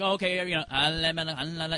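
low, droning rote babble; vain repetition. Retold or imitated. Showing prayer done by habit every hour, droning rote recital. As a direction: reel it off mindlessly on one low note. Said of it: "like a vain repetition".

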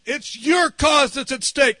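high, emphatic plea, slowing on key words; retold fervent prayer. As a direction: plead your case urgently to the Lord. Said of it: "I was praying that".

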